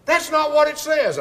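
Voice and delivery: loud, high indignant yell; imitating the caller shouting back; retold yell. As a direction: caller yelling his objection. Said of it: "he would yell".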